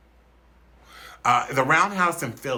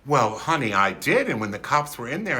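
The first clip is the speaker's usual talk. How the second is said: normal talking voice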